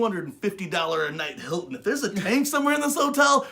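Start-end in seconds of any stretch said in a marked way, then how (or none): 1.7-3.5 loud and high, half shouting; a loud mock-outraged complaint; indignant rant. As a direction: rant like you've been cheated. Ranting about a hidden tank.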